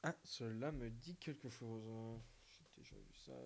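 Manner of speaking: hushed breathy whisper. Shown as a demonstration. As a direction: whisper it close. Hushed take of the line.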